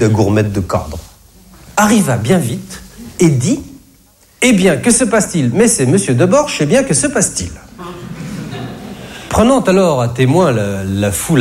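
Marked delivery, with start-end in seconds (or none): 4.4-7.5 manager voice. Retold or imitated.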